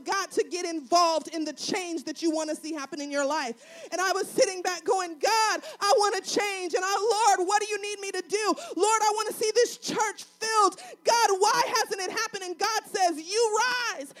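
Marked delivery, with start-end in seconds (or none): 3.9-12.4 loud, rising pulpit cry; shouting pleas to God from the pulpit; full-force preaching. Real, not acted. 12.4-14.1 loud, rising pulpit cry; shouting pleas to God from the pulpit; full-force preaching. Real, not acted.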